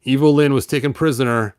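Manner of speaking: ordinary delivery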